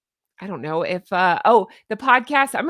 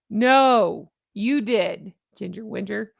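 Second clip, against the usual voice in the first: ordinary talk, nothing marked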